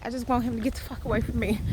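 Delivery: ordinary talk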